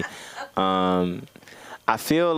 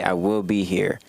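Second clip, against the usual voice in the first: ordinary talk, nothing marked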